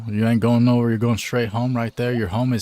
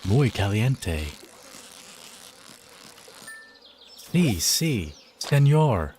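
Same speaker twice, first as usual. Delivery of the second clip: mock Spanish accent; much slower than usual. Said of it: "I love using, like, really American accents".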